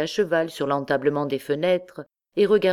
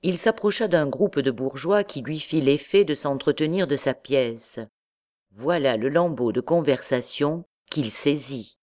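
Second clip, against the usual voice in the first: ordinary delivery, nothing marked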